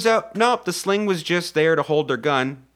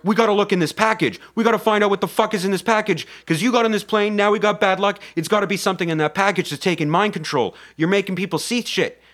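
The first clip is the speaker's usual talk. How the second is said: fast, higher-pitched heated demands; acted accusation; 6 semitones higher, faster than usual. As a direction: play an agitated passenger pressing an accusation. Acted quarrel over the package.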